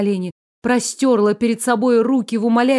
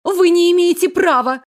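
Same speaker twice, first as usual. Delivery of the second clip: high, loud scared protest; frightened outcry; 8 semitones higher, 4 dB louder than usual. A narrator's reading. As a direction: cry out in fear. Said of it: "подала голос Каролина".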